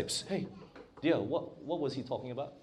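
acted hushed question to a spouse; very soft, confidential, like nudging a partner; hushed aside. Puzzled churchgoer asking spouse at home.

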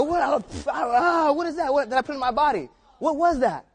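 retelling a friend's grossed-out outburst; disgusted panic. Retold or imitated. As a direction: spit it out and gag on the words. Spitting food out the car window.

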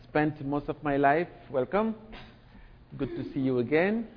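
ordinary delivery

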